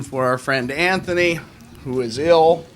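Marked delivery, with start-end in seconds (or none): none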